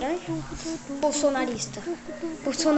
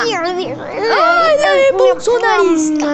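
loud, high yelp after a bite; pained yelp; 6 semitones higher, 9 dB louder than usual. Acted. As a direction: cry out as if bitten. Bitten, cries out.

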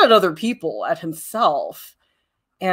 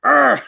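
short, loud playful growl-grunt; demonstrated grunt; 5 semitones higher, 5 dB louder than usual. Shown as a demonstration. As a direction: grunt in place of a swear word. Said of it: "replace that with grunts".

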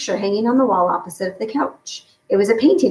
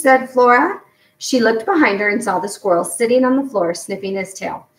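usual speaking voice

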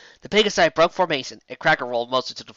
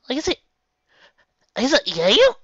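amazed disbelief; 11 semitones higher, 6 dB louder than usual. Acted. Megan disbelieving.

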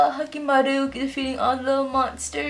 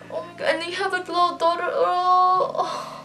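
high, teary voice with laughs; half crying, half laughing from joy; real happy tears; 6 semitones higher, 4 dB quieter than usual. Real, not acted. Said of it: "I'm legit crying".

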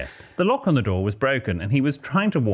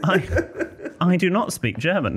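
reading while laughing, higher; 8 semitones higher than usual. Real, not acted. Reading the listener's letter while laughing. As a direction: laugh through the line. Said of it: "laughing reread".

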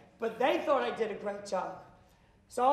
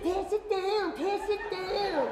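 higher, slow, commanding pass it down; fired-up vendor; 7 semitones higher, slower than usual. Retold or imitated. Pumped vendor telling fans to pass the lemonade, slow and showy. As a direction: drag it out like a showman.

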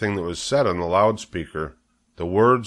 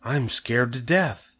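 hushed, slow and higher-pitched; frightened whisper; 4 semitones higher, slower than usual. A narrator's reading. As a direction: confide your fright in a stagy whisper. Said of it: "whispered Missus Zuckerman".

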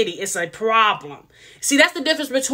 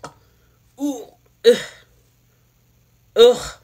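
disgusted groans. Retold or imitated. Vlogger showing how crying Justin makes her feel, disgusted groans. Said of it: "It makes me literally like".

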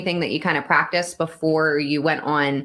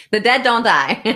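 "The dead don't die" said a little higher and louder, like a movie tagline; mock ominous; 6 semitones higher, 5 dB louder than usual. Host teasing the actor about his death, mock ominous. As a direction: deliver it like a spooky trailer line. Joking line about dying character.

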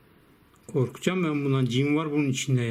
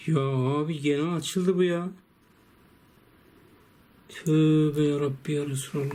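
ordinary talk

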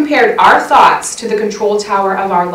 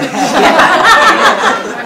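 laugh; 11 semitones higher, 6 dB louder than usual. Real, not acted. Laughing at her own skunk story.

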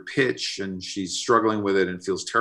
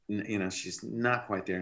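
usual speaking voice

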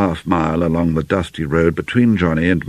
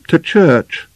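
bitterly; 11 semitones higher, 5 dB louder than usual. A narrator's reading. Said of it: "she said bitterly".

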